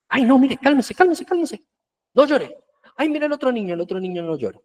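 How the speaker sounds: put-on high, fussy shushing of a crying child; hushing parent.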